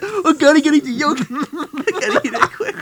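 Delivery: put-on laughing frantic kid voice; mock urgency. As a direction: panic through giggles. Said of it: "He's like".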